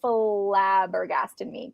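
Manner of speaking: normal talking voice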